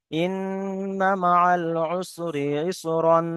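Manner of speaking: slow drawn-out tajweed recitation; Quranic recitation. Shown as a demonstration. Teacher to students, showing the wrong ending, slow recitation. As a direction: recite slowly, ending on the nasal ইসরান. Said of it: "এখানে দেখেন".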